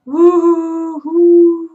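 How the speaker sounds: long, slow, loud hooting owl call; eerie drawn-out owl hoot; ghostly owl hoot. A narrator's reading. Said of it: "came a ghostly whisper".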